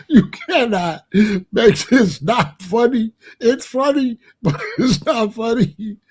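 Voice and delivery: guilty laughing protest; real laughter leaking through. Real, not acted. Laughing despite tragic story.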